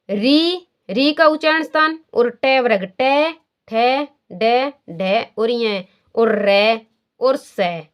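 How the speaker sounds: टै, ठै, डै, ढै said very slowly; slow letter demo. Shown as a demonstration. Teacher sounding out retroflex letters, slow and drawn. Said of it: "उसके बाद में आ गया री".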